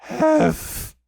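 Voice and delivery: high, loud puffed-out gasp; Piglet out of breath. A narrator's reading. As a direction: burst it out, too winded to finish. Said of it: "breathing so hard that he could hardly speak".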